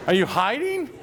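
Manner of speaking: high, laughing teasing question; laughing disbelief. Real, not acted. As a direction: laugh through the words, calling him out. Teasing missing colleague Colin.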